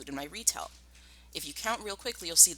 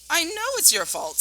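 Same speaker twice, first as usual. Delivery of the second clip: furiously; 13 semitones higher, 6 dB louder than usual. Acted. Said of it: "said Badger furiously".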